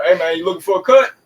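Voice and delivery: barber calling out. Retold or imitated. Barbers calling to them in the shop, loud quick call. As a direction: hustle him into the chair.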